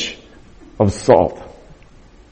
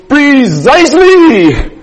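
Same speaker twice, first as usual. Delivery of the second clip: high, loud, slow triumphant cry with a laugh; hammed-up triumphant teacher; Raj exclaiming; 12 semitones higher, 5 dB louder, much slower than usual. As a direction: throw the hands up and crow it. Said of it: "his hands went up, his eyes got big".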